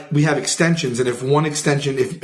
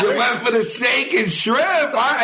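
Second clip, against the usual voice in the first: teasing; 7 semitones higher than usual. Retold or imitated. Coworker teasing him on phone.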